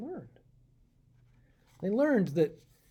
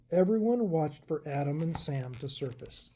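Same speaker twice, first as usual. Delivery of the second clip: usual speaking voice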